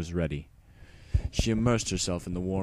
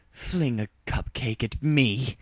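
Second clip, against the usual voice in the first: seething; 5 semitones higher, slower than usual. Acted. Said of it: "Luna seethed".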